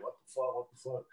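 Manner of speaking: hushed what the fuck, twice; stunned mutter. Retold or imitated. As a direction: mutter it to yourself in shock. Stunned disbelief at the news.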